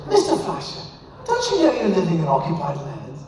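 mock British accent. Journalist accusing him of living in occupied land, British accent. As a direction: imitate a challenging journalist in put-on British. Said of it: "it's gonna be British".

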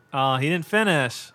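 usual speaking voice

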